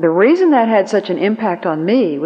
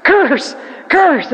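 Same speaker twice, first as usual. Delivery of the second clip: alarm-like cry; 11 semitones higher, much slower than usual. Retold or imitated. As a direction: blare each word like a flashing warning sign. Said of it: "like a neon sign went off in my mind".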